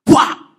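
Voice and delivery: sudden very loud bang noise; explosive vocal thud; imitated impact. Preacher imitating a curse hitting its sender, loud bang.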